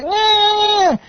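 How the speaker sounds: put-on high little-kid wail; mock child's bawl. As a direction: imitate a small child whining down the phone. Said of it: "I just hear a kid like".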